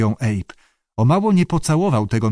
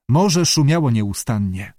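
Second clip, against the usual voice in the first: ordinary delivery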